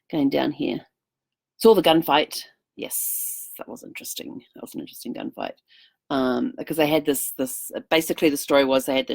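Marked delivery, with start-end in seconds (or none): 2.8-5.6 soft breathy whisper, almost to herself; whispered aside. Real, not acted. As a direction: drop to a whisper for a wry aside. Whispered aside about the gunfight.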